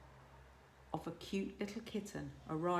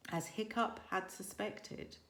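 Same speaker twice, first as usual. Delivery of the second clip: usual speaking voice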